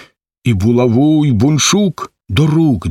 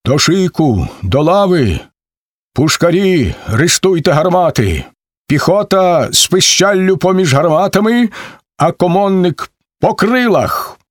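raised battle commands; commanding orders; 6 semitones higher than usual. A narrator's reading. Somko orders his Cossacks into battle, commanding. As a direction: drill the troops into line. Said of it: "Покликне Сомко".

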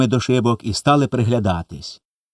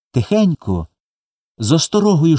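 ordinary delivery

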